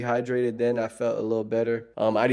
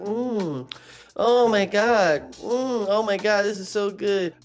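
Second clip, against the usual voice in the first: high, exaggerated moans of delight; 9 semitones higher, slower than usual. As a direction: mimic her savoring every bite, way up high. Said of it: "She's like".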